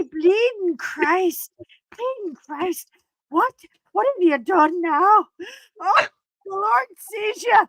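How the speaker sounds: mock Irish mother scolding, rising to a shout; exasperated Irish mum cursing her child in an Irish accent. Daughter imitating her Irish mother scolding her in an Irish accent. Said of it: "do the Irish accent".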